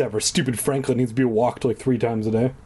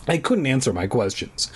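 ordinary delivery

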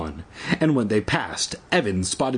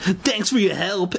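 sarcastic shout; 7 semitones higher than usual. A narrator's reading. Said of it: "Evan shouted sarcastically".

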